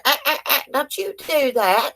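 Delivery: put-on high, sharp warning to a misbehaving kid; retold stern scolding. Neighbor's boy jerking the beagle's tail, retold stern high scolding.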